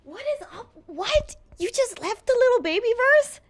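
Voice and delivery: high, incredulous squeal of disbelief; can't believe what she just heard; shocked disbelief. Real, not acted. Interviewer reacts to the rapper turning down a verse, shocked and high.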